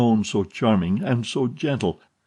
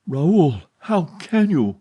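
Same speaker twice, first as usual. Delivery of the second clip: much higher, louder hurt reproach; wounded cry; 8 semitones higher, 5 dB louder, slower than usual. A narrator's reading. Heroine hurt by her lover's insult, wounded cry. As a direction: reach out, stung by his cruelty.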